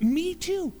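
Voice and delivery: high, eager, delighted agreement; eager me too. Acted. Man on the bridge eagerly agrees with the jumper, high and delighted. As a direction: jump in happily like a new friend.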